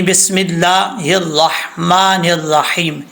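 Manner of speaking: slow, measured Arabic recitation; reciting the tasmiya. Shown as a demonstration. Teacher names the opening formula for students, slow measured recitation. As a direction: recite it deliberately, syllable by syllable. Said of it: "Tasmiya".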